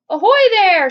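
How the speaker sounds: loud, high hail upward; police chief shout. A narrator's reading. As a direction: bellow up at the rooftop. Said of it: "shouted the chief of police".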